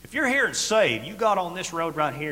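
sudden high, loud call to the saved; preaching shout. Real, not acted. Preacher to congregation, a loud rising call. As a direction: cry it out from the pulpit.

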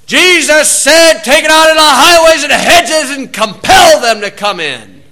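high shouted quote, pitch up, full volume; yelled scripture. Retold or imitated. Preacher yelling back at heckler from street corner, shouting. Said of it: "I yelled".

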